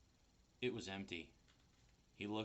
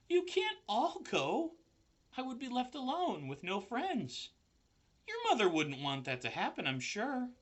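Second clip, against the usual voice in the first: Wilbur begging not to be left alone; upset pig begging; pleading pig; 14 semitones higher, 6 dB louder than usual. A narrator's reading.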